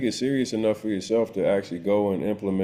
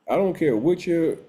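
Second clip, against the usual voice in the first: ordinary talk, nothing marked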